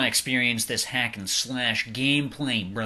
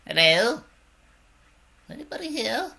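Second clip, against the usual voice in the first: much higher timid call; 9 semitones higher than usual. Pretend. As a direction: call out into the empty room. High calling voice.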